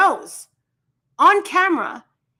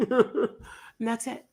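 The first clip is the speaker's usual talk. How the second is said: laughing; 2 semitones lower, 6 dB quieter, much slower than usual. Real, not acted. Laughs while saying it.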